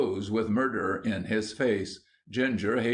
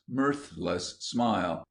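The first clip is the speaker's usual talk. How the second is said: plain reading voice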